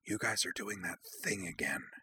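teasing whisper to the couple. A narrator's reading. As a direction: whisper it between two friends. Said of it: "leaned her head between theirs and whispered".

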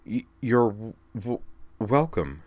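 halting, stammered thanks, a touch slow; flustered stammer. Acted. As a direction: trip over every word, caught off guard. Flustered Kate stammers a reply.

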